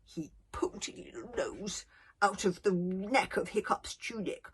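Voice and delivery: ordinary delivery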